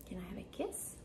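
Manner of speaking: soft whispered request. Real, not acted. Owner asking her parrot for a kiss, whispered. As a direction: whisper it to the bird.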